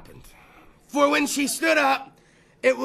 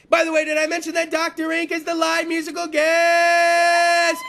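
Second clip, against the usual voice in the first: higher, slower shouted introduction, stretching the last word out long; announcer hype; 6 semitones higher, 4 dB louder than usual. Acted. Host announcing the live musical guest, hyped shout. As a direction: belt it out like a ring announcer.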